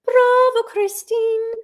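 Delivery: high stage voice; imitating the ballerina. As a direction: pitch it high like the dancer. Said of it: "She said".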